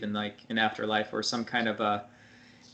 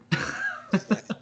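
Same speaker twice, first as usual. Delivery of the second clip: laugh; 9 semitones higher than usual. Real, not acted. Friend laughing at story.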